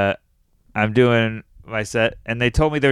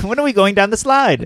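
put-on higher little-girl voice, a bit louder; 8 semitones higher, 4 dB louder than usual. Comic voicing the girl Caitlin asking about a date, higher child voice.

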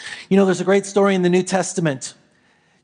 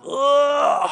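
waking groan; 6 semitones higher than usual. Acted. Jesus waking up.

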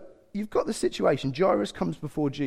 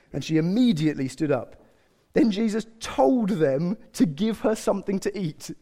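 normal talking voice

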